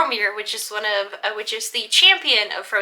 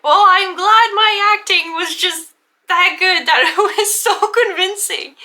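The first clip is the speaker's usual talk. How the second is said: higher, louder, slow smug delight with a laugh; mock proud; 8 semitones higher, 8 dB louder, slower than usual. Player teases Kaju about her acting, high and smug. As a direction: preen over your own great acting.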